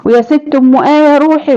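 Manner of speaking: high, loud call across the house. Real, not acted. Cook in her kitchen calls out loudly to neighbour Umm Aya.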